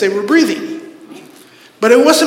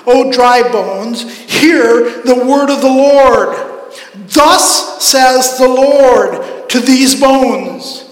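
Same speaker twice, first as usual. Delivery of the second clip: loud, raised, drawn-out proclamation; preaching at full force; 4 semitones higher, 7 dB louder, much slower than usual. Acted. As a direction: declaim the prophecy slowly and loudly. Declaims scripture at full force.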